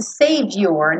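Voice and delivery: savior said "sevior", weak final vowel, slow; reduced vowel. Shown as a demonstration. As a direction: keep the last vowel of savior lax. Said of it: "não precisa se preocupar com esse U".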